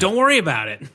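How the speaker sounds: high, loud brush-off; imitated touchy dismissal; retold defensive retort. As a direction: wave off the question loudly. Retold wife snapping back at husband.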